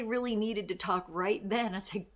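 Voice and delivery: ordinary talk, nothing marked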